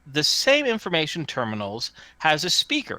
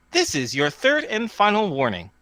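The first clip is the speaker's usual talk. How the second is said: higher automated announcement voice; terminal warning; 6 semitones higher than usual. Acted. Terminal giving dog boy final warning, automated voice. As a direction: deliver a formal machine warning.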